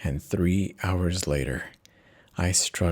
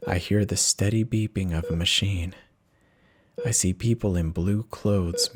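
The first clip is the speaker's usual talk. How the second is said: ordinary delivery, nothing marked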